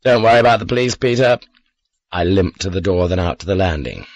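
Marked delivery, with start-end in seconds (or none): 0.0-1.4 calling out. Acted. Calling to armed man downstairs.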